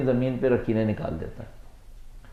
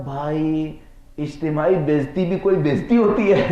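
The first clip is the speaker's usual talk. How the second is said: amused punchline; 4 semitones higher, 4 dB louder than usual. Retold or imitated. Colleague consoling the humiliated manager in a joke, amused. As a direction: voice climbing high and louder toward a laugh.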